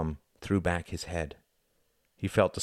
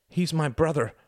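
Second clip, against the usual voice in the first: earnest insistence; 9 semitones higher, 4 dB louder than usual. Acted. Tom answers captors earnestly.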